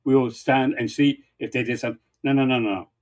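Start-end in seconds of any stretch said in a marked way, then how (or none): none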